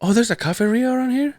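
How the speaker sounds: high-pitched sister voice, rising question; high, mock-innocent query; imitating sister. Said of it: "and my sister goes".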